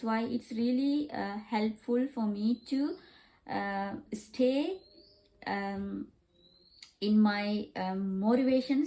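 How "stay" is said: ordinary talk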